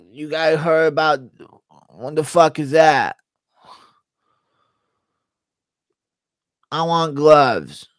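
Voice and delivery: lightly voiced tough fighter, indignant; a hint of a confused brawler; Diaz impression. Fighter balking at a contract, mild indignant drawl. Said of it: "Diaz trying to understand his B K F C contract impression".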